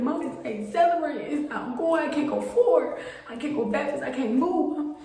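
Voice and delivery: high, desperate pleading; high-pitched frantic list of failures; panicked. Retold or imitated. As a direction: near tears explaining to mom. Daughter panicking to mother.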